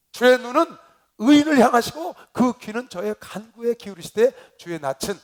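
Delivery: loud, high scripture declamation; scripture reading. Real, not acted. Pastor declaiming scripture to the congregation, loud and high. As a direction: proclaim the verse loudly, pitched high.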